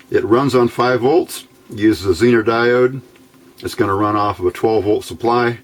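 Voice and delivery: ordinary talk, nothing marked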